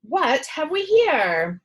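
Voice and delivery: put-on doctor greeting the boy, jolly and loud. A narrator's reading. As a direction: boom it out like a jovial old doctor. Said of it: "he asked".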